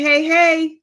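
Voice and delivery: ordinary delivery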